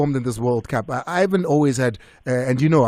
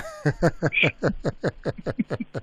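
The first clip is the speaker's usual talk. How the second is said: real, drawn-out chuckling laugh; amused rolling laughter; long laugh; 3 semitones higher, 5 dB quieter than usual. Real, not acted. Host laughs at caller's remark.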